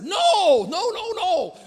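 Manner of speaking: loud, high, repeated no; emphatic denial. Real, not acted. Pastor rejecting the label Scott the cusser. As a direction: shut the idea down hard. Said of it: "denying being Scott the cusser".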